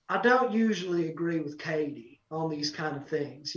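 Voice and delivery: lightly imitated judge, low and soft. Country star judge disagreeing with fellow judge, low and measured. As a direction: drop low and quiet for the judge's verdict. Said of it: "And Luke goes".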